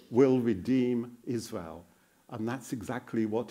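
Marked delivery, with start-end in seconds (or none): none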